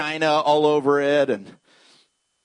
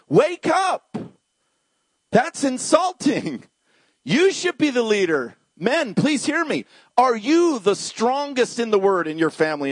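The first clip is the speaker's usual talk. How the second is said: high, emphatic preacher's challenge; urgent high-pitched exhortation; impassioned rebuke; 6 semitones higher than usual. Real, not acted. Exhorts complacent men in congregation.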